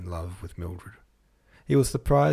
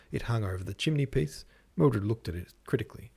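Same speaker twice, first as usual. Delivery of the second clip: usual speaking voice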